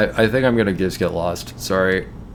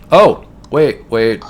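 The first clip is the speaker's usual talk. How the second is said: sudden alarm; 3 semitones higher, 8 dB louder, much slower than usual. Real, not acted. Player nearly crashing, sudden loud alarm. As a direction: blurt it out in alarm. Near mishap in the game.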